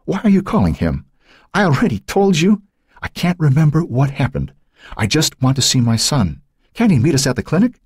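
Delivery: high, agitated, strained woman; anxious mother's pleading objection; protesting. A narrator's reading. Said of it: "tried to protest".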